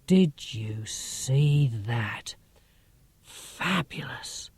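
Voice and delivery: slow, breathless whisper of wonder; barely-there whisper, rooted to the spot; awed whisper. Acted. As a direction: hush it, still stunned by the lights. Awed whisper after seeing UFO.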